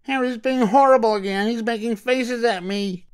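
a hammed-up tattle; whiny wail. Matilda wails to mother about Harry, high whine. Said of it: "wailed Matilda".

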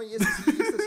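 high, loud, laughing insistence; excited mock insistence. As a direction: hype it up, laughing. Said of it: "Pues sí estuvo como así".

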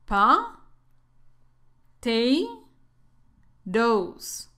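"Pa", "Te", "Dos" as separate English syllables, long pauses between; teacher's drill, each of "Pa. Te. Dos." said alone. Shown as a demonstration. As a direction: break potatoes into "Pa. Te. Dos.".

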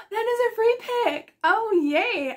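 high-pitched, gasping excitement; thrilled. Real, not acted. Vlogger discovering she drew a free pick, thrilled. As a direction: exclaim it high and drawn out, overjoyed.

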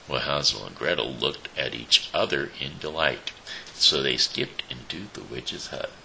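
ordinary delivery

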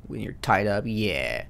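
ordinary talk

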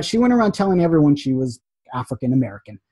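ordinary delivery

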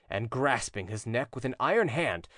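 plain reading voice

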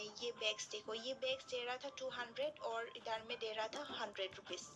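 ordinary delivery

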